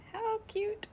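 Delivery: high cooing over a cute picture; high delighted coo at the page. Real, not acted. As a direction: coo it like you've seen a puppy. Coos at picture.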